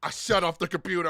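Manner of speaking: normal talking voice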